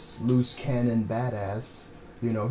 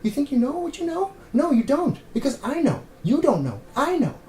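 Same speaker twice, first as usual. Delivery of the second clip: much higher, louder hammed-up taunt; mocking taunt; 5 dB louder than usual. Reviewer parodying Wahlberg's swagger: much higher, louder mock taunt. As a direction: squeal it like a smug know-it-all. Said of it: "just walking around going".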